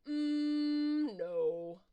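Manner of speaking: loud, smug refusal in a worm voice; worm refusing. Acted. Hypodermic worm loudly refuses to leave the player alone. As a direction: hum it out loud, then flatly refuse.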